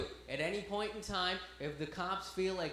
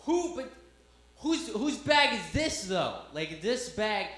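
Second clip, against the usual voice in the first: loud, slow, exasperated repeat; insistent officer; 4 semitones higher, 6 dB louder, slower than usual. Retold or imitated. Officer keeps asking who owns the bag, loud exasperated insistence. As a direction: press the question, high at first. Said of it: "he keeps going".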